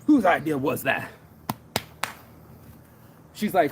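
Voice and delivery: normal talking voice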